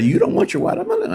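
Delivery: put-on high, rising German accent for the lunch lady; German lunch lady asking in a high thick accent. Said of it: "she had this thick German accent".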